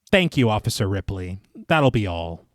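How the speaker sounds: low, even official dismissal; curt dismissal. Acted. Chairman excuses Ripley from the proceedings, low and dismissive.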